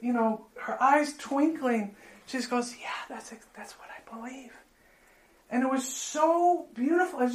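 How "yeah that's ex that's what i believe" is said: whisper. Retold or imitated. Tara answering softly, tearful moment.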